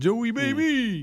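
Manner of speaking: put-on high, slow, drawn-out Joey Baby; mock Ange greeting. Host mimicking Ange greeting Biden, high and stretched.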